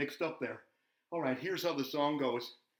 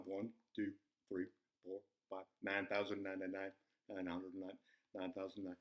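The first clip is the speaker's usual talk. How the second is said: counting under breath; 7 semitones lower, 10 dB quieter than usual. Acted. Counting steps under his breath.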